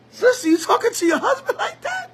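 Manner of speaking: ordinary talk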